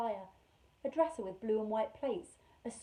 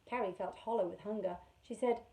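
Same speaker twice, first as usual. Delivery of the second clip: plain reading voice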